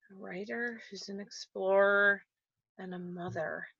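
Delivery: very soft, to yourself; thinking aloud. Real, not acted. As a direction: piece the clues together under your breath. Guest puzzles over clues to herself.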